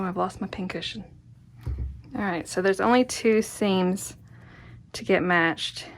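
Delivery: ordinary delivery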